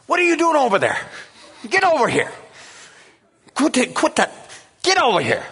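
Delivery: high, scolding lady calling across the room; high scolding hostess voice; bossy host lady. Retold or imitated. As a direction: shoo him back like a fussy hostess.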